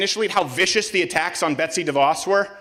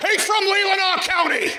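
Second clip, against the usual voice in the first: mocking voice; 8 semitones higher than usual. Mocking the Traverse City fan, a hammed-up higher shout. Mocking the audience member's answer.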